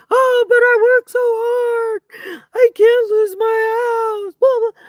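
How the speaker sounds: high, loud, whiny fake sobbing; mock her crocodile tears, sobbing high and loud; mocking fake crying. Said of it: "those tears dried up so quick".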